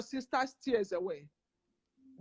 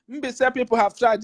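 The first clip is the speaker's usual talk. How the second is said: loud praise; 10 dB louder than usual. Real, not acted.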